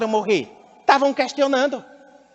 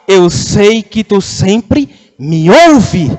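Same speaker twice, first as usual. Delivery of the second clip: slow, raised proclamation; voz alta; 3 semitones lower, 7 dB louder, much slower than usual. Acted. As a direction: proclaim it aloud, drawing out each phrase. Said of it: "Ele fala em voz alta e ele diz". (em voz alta, bem pausado)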